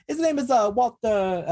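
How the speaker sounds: put-on high, hammy Italian accent; thick Italian accent. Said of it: "I'll use a thick Italian accent".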